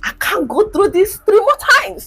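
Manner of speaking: high, loud, worn-out protest; exasperated. Retold or imitated. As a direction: cry out that you're done with labor. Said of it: "I was like".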